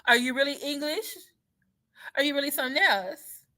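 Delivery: mocking turnaround. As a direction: flip the question back with sass.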